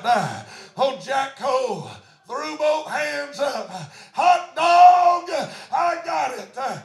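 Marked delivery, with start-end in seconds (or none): 4.1-6.6 loud, high exclamation of joy; hot dog, hollered with glee; joyful shout. Retold or imitated. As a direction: shout it out like a man just saved. Threw both hands up in store.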